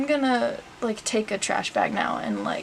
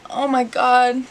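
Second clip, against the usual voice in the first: normal talking voice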